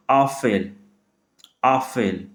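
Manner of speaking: आफेल with the first vowel stretched and stressed; teacher demo of आफेल, vowel stressed; German word Apfel. Shown as a demonstration. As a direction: say आफेल leaning on the opening आ. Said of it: "मैं vowel पे ज़्यादा ध्यान दे रहा हूँ".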